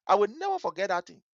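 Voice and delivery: plain reading voice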